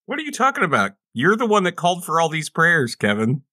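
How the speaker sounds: usual speaking voice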